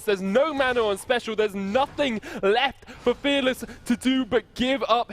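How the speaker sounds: high-pitched excited casting call; hyped play-by-play. Acted. Caster calling a multikill teamfight, excited. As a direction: ride the fight's rising hype.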